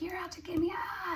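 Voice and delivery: high, shrill-free mock yell of accusation; hammed-up paranoid outburst. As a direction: lash out in a high mock cry. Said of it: "they're like".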